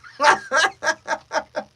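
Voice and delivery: loud, very high villain cackle; high-pitched laugh. Shown as a demonstration. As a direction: push the cackle up as high as it goes. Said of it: "let's see how high it goes".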